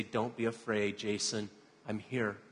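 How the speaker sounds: low, soft imagined voice of comfort; gentle reassurance. Retold or imitated. God to the preacher in his fear, gentle reassurance. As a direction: soothe tenderly, dropping the voice.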